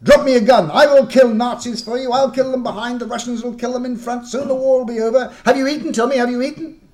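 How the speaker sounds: put-on loud, high excited French-accented plea; fierce eagerness. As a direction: burst with eager hospitality. Fierce welcome, raising fist.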